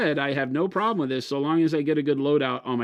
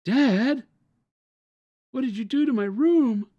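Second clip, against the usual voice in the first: high, whiny "Dad"; whiny son; 11 semitones higher, 3 dB louder, slower than usual. Pretend. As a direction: voice the upset college kid. Son voiced.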